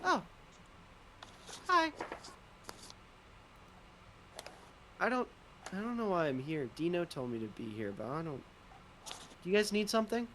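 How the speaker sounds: lightly voiced timid little creature, trailing off; shy creature voice. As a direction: mumble it shyly, unsure why you're here. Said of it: "he looks up and he goes".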